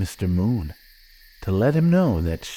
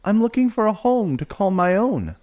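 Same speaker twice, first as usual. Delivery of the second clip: high, small wolf-pup voice; little wolf character; 11 semitones higher, 3 dB louder than usual. Acted. Willow answering the beaver, high little wolf voice. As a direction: lift the pitch for a young animal. Young wolf replies to fairy.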